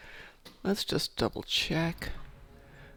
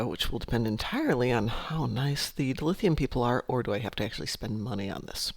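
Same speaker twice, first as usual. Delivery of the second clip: ordinary talk, nothing marked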